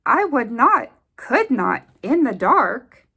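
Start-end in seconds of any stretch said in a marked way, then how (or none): none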